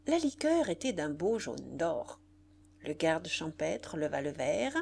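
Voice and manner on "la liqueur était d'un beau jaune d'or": plain reading voice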